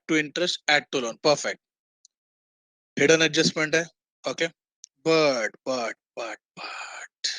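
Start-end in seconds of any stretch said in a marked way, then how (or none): 6.2-7.3 whispered, much softer but, but. Real, not acted. Teacher teasing a hidden catch to students, soft whisper. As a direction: drop to a secretive whisper on but, but.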